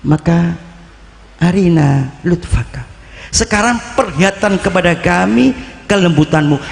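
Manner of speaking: high, fervent, slowed supplication; fervent prayer retold. The preacher retelling the saint's storm prayer, fervent and high. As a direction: pray with building awe.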